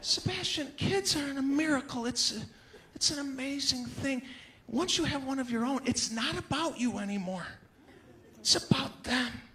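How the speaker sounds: preachy parents. Retold or imitated. Mock preachy parent friends.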